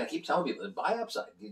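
ordinary delivery

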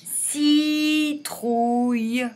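very slow, drawn out; teacher sounding out Citrouille; slow model word. Shown as a demonstration. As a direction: stretch every sound of Citrouille. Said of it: "on entend".

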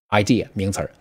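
normal talking voice

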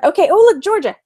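normal talking voice

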